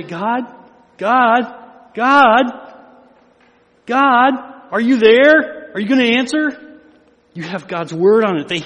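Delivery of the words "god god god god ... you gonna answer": growing louder and higher, slowing on the question; hammed-up anxious calling out to God; mock desperate pleading. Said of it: "You don't have to say".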